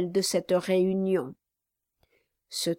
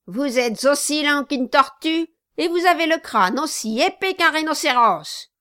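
louder, higher, faster sneering insult; impatient insult; 6 semitones higher, 5 dB louder than usual. Acted. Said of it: "avec un geste d'impatience".

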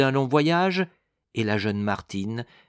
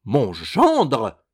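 loud, swooping exclamation; startled cry; 5 dB louder than usual. A narrator's reading. Madame spotting her son-in-law, crying out in surprise. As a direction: cry out on suddenly spotting someone. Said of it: "s'écria-t-elle".

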